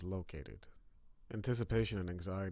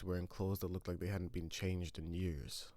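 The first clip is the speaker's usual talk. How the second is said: ordinary delivery, nothing marked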